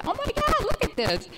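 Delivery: squealed way up high; thrilled at finding money; giddy squeal over coins in a machine. Retold or imitated. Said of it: "so giddy".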